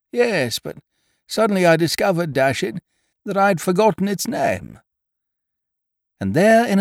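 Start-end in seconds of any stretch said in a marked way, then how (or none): 0.1-4.8 higher-pitched plaintive dimwit; hapless, muddled chap. A narrator's reading. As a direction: recount your woes as a helpless silly friend. Biffy voiced by narrator.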